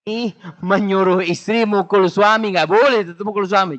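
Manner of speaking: high, loud playful scolding; mock scold. Real, not acted. Preacher jokingly forbids wives hitting husbands, loud and high. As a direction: wag a finger at the crowd.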